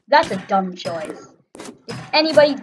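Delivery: ordinary talk, nothing marked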